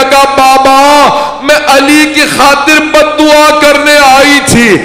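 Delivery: high, slow, drawn-out keening recitation; wailing lament. Acted. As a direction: stretch every word into a mourning wail. Climax of the majlis lament. (ऊँची, धीमी, मातमी विलाप की आवाज़)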